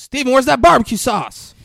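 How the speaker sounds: high, very loud holler; yelling. Retold or imitated. Dad yells for Steven still taking long, yelling. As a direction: imitate a dad bellowing to another room.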